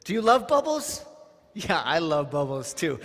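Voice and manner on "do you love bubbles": put-on high, louder question to a small child; playful high question. Leader asking a little child about bubbles, excited. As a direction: ask it like a delighted kindergarten teacher.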